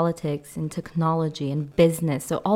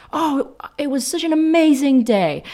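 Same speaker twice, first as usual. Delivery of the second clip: emphatic amazing; 8 semitones higher, 4 dB louder than usual. Shown as a demonstration. Example of strong old meaning.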